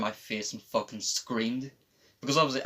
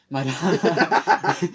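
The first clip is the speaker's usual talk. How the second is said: voice breaking; 5 semitones higher, 8 dB louder than usual. Real, not acted. Telling the ambulance story, voice cracking. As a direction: voice cracks high mid-word with a laugh. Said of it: "voice break".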